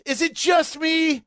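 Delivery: usual speaking voice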